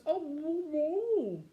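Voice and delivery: high, loud mouth-full-of-toffee mumble; Fanny mocks Moonface's toffee-stuffed mumble, high and loud; mock muffled mumble. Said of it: "all you could say was".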